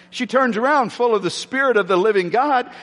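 normal talking voice

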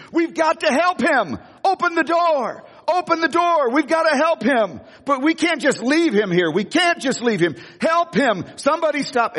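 high, frantic pleas to help; panicked screaming. Retold or imitated. Ladies in the back begging the driver, high and frantic. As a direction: cry out in panic to stop the van. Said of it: "became unhinged and started screaming".

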